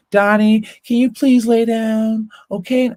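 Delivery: high, slow mom over an intercom; mock a too-nice parent asking politely; imitated friendly pleading. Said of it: "friendly with him over the fucking Alexa".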